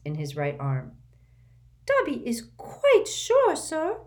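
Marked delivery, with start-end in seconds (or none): 1.8-4.0 much higher, slow, earnest elf voice; a house elf vowing he's certain; earnest squeaky elf. A narrator's reading. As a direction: reassure him solemnly in a squeak. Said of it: "said the elf earnestly".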